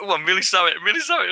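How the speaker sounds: high-pitched, repeated apology; mock flustered apology. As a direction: imitate a performer hurriedly saying sorry. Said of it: "went up to her and went".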